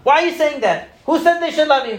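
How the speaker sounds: hammed-up aggressive grilling; combative challenge. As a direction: imitate a pushy therapist firing back at a patient. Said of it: "He was combative".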